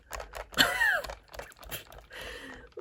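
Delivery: raspy smoker's cackle; a rough, husky laugh. Real, not acted. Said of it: "Why do I sound like a smoker when I laugh like that".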